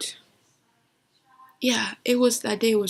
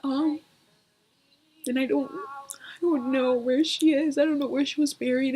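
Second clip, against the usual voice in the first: higher, grief-stricken voice; tearful; 5 semitones higher than usual. Real, not acted. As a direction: fight back real tears as you speak. Grieving over unknown burial place.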